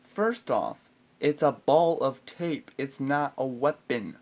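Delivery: slow, emphatic exasperation; indignant rebuttal. Real, not acted. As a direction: hammer out each word slowly and forcefully.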